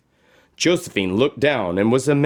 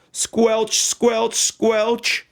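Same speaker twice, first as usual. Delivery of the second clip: higher, squishy squelches; squelch sound words; 10 semitones higher, much slower than usual. A narrator's reading. Narrator sounding boots in a bog, higher squelches. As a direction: make the mud squelch. Wellies in the mud.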